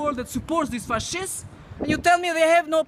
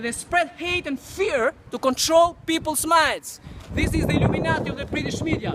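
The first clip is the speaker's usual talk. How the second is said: normal talking voice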